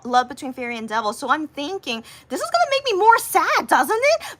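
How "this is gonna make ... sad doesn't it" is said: much higher, louder fretting question; wondering if a sad drama makes it worse, mock worry; mock worried thought. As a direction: fret aloud in a high voice. Worried inner thought, retold.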